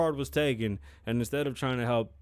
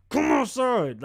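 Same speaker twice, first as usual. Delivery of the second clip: a hint of a stern lawman, louder and high; stern and serious; 11 semitones higher, 6 dB louder, slower than usual. Dog demanding someone come outside, lightly voiced stern order. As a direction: call through the door like a bounty hunter, mock stern. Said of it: "he was so stern and serious".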